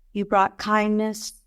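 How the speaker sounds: ordinary talk, nothing marked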